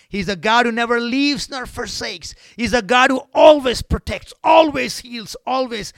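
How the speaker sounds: high, loud shouted praise; preaching at full force. Acted. Preacher to congregation, preaching at full force. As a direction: preach it out with fire.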